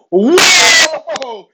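high, loud, drawn-out whoa; stretched comic cry of shock; alarmed whoa. Retold or imitated. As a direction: recoil in exaggerated alarm. Reacting to her grabbing it.

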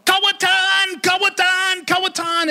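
high, shrill repeated accusation; imitating a furious taxi driver's yell. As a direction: cry out "Kawatan" like you've been robbed. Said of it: "begins to yell".

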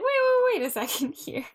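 sudden high, loud "wait" with a laugh; mock alarm. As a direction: cut in as if alarmed.

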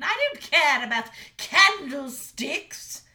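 high, loud blunt refusal; stern mother dragon. A narrator's reading. Mother dragon bluntly refusing her son, high and loud. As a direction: shut him down hard.

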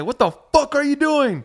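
high-pitched, drawn-out mock outburst; mock outrage. Host imagining his reply to being pulled, mock outrage. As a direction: act out an indignant, slow "what the" reaction. Acts out his own angrier reaction.

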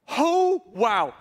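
high drawn-out oh, dropping to wow; retold gasp of wonder in words; awed realization. Preacher reliving a sudden revelation, awestruck.

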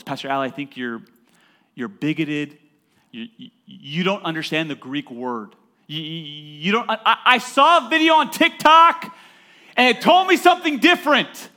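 mock an indignant TikTok viewer; mock angry emailer. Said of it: "This is how the email goes".

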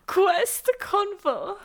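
Quest Convo drawn out slowly, rising high; fancy cursive voice. Acted. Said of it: "You just spoke in cursive".

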